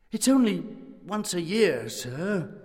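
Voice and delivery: ordinary delivery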